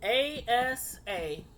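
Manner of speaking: each letter said slowly and separately: A, S, A; spelled out letter by letter. Shown as a demonstration. Spelling the name A S A slowly for viewers.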